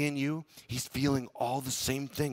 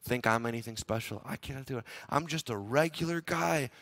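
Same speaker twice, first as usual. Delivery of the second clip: humble reply; 5 semitones lower, 7 dB quieter than usual. Retold or imitated. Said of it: "Joseph replied to Pharaoh".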